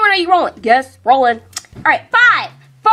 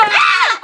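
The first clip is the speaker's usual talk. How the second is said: sudden high yelp; startled shriek. Acted. Startled by Cameron, a sudden high yelp. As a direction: jump and yelp in fright.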